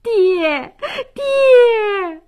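high, drawn-out wail; anguished high-pitched sobbing call; crying out. Acted. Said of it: "哭喊着".

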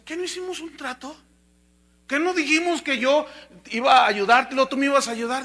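remonstrate, building to a loud finish; indignant protest. Acted. Deer reminds the wolf of their deal, indignant.

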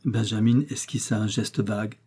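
ordinary delivery, nothing marked

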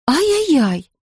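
mildly worried exclamation; alarmed. Acted. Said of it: "встревожилась миссис Браун".

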